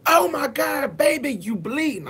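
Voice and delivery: imitating a lady's high, loud scream; screamed alarm. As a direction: shriek it high in alarm, as a shocked onlooker. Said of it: "when this lady screamed, she said".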